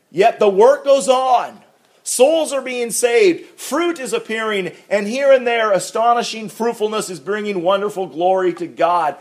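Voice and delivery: high, loud preaching build; fervent preaching. Acted. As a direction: lift it up like a pulpit climax. Preaching at full force.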